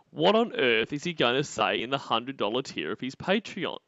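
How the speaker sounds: ordinary talk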